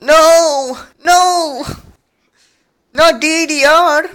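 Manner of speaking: high, loud, drawn-out no; loud mock protest. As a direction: wail in hammed-up dismay. Mock protest at mention of DDR.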